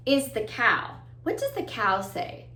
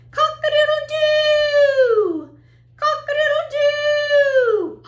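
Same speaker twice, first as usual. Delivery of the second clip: very high, loud crow with a long final "doo"; rooster crow; 8 dB louder, slower than usual. Shown as a demonstration. Teacher playing the rooster for children, loud high crowing. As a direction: crow it out like a rooster, stretching the end. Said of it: "Let's listen to that".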